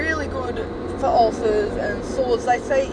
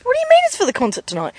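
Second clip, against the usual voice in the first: alarmed mum; 13 semitones higher, 4 dB louder, much faster than usual. Retold or imitated. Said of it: "And her mum goes".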